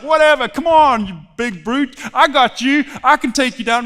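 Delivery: loud, cocky taunt; mock bravado. Preacher plays would-be David taunting the giant, cocky. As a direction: brush off a huge opponent with pretend swagger.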